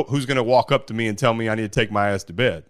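normal talking voice

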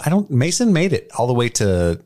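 ordinary talk

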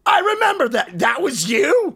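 high-pitched imitation of the tour manager's accent; Steve Wood's accent. Tour manager recalls the incident, high voice in his accent. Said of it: "in his accent".

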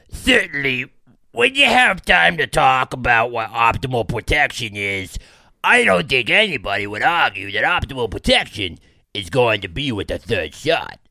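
high, loud put-on impersonation; Fauci. Host quoting the health official to co-host, loud high impression. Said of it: "I kinda like that better than the last voice".

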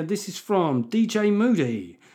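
ordinary talk, nothing marked

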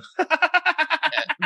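very high put-on cackle; long, high fake laughing fit; mock laugh. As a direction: cackle over a weeping family. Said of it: "I start going".

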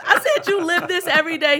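high and loud, half laughing; excited. Real, not acted. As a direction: still buzzing with delight.

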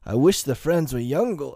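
put-on high, amused old friend; jovial, animated character voice; chuckling. A narrator's reading. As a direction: say it through a light chuckle. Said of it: "chuckled Doctor Lanyon".

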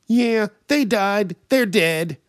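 loud, high, slow mimicry; high-pitched mocking caricature; obnoxious spoiler guy. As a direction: blurt it out as an oblivious loudmouth.

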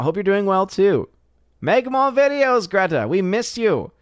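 high squeaky kitty voice; cat voice. Acted. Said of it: "And yes, I am doing the cat voice".

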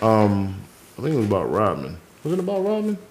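muttering, lost mid-thought; brain freeze. Real, not acted. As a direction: trail off, forgetting what to say. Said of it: "Fuck, I got a brain freeze".

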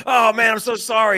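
loud, high-pitched mock apology; retold effusive apology. Fan apologizing to the upset stranger, hammed-up loud apology.

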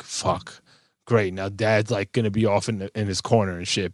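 low, soft resigned grumble; mock dejected kid. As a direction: voice the kids' let-down under your breath. Voicing kids' dismay at dad.

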